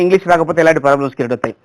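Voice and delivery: normal talking voice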